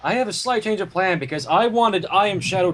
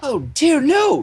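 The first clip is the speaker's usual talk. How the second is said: higher, bubbly cartoon pony voice; Pinkie Pie voice; 9 semitones higher, 4 dB louder, slower than usual. Pinkie greeting the Cakes, higher bubbly pony voice. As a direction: chirp it like an eager pink pony.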